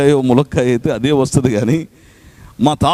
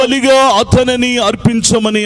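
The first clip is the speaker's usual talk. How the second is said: forceful preaching; 11 semitones higher than usual. Acted. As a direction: proclaim each phrase to the hall. Preacher repeats scripture forcefully. (బలంగా ప్రసంగం)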